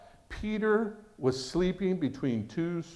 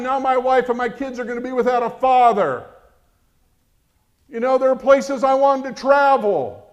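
yelling at God; 7 semitones higher, 10 dB louder than usual. Retold or imitated. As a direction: rage at God over a life cut short. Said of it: "yelling at God".

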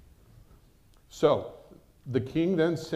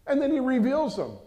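normal talking voice

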